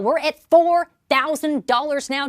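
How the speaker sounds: normal talking voice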